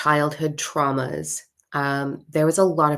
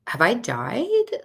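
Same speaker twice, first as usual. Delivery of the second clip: slow question swooping up high; stunned disbelief; 6 semitones higher, much slower than usual. Retold or imitated. Woman reacting to hundreds of spirit children, stunned. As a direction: ask it slowly, voice climbing in disbelief.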